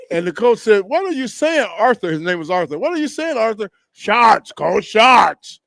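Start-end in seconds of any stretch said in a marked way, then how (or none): 0.8-2.1 high-pitched, incredulous question; mock bewilderment, pitch jumping up; baffled coach. Retold baffled coach questioning his player. 2.8-3.7 high-pitched, incredulous question; mock bewilderment, pitch jumping up; baffled coach. Retold baffled coach asking once more. 3.9-5.6 put-on louder, insistent Massachusetts accent, "shorts" as "shots"; emphatic mock Massachusetts accent; Massachusetts teammate.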